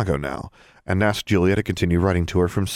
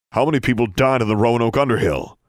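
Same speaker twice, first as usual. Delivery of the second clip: heated; 4 semitones higher, 3 dB louder than usual. A narrator's reading. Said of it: "sounding less grandfatherly all the time".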